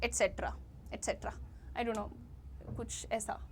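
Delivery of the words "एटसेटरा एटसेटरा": एटसेटरा as et-SET-ra, three syllables; lower, vowel dropped in एटसेटरा; common mistaken form. Retold or imitated. Teacher imitating many people, एटसेटरा as et-setra. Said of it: "बहुत सारे लोग कहते हैं".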